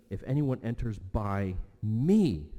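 slow, with ME punched and stretched; deliberate, swelling stress on ME; emphatic. Retold or imitated. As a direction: lean on "by me", pausing before ME. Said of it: "It's emphatic, singular".

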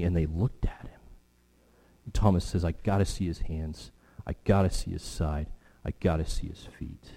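low, soft voice of a grieving doubter; hushed, grave preaching. Acted. Preacher speaks as Thomas needing to see the wounds, hushed. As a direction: drop low and quiet, letting pauses hang.

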